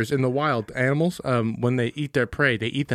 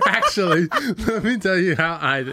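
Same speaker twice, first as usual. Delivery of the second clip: higher-pitched teasing imitation through laughter; mocking co-host imitation; 6 semitones higher than usual. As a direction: mimic a know-it-all friend, laughing. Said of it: "you cross your legs and you're like".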